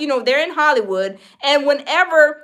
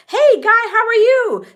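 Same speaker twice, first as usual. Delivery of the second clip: high, louder fake-friendly hello; imitated smooth greeter; 8 semitones higher, 5 dB louder than usual. Hollywood insider greeting a young newcomer, high fake-friendly hello. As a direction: pitch it up and oversell the welcome.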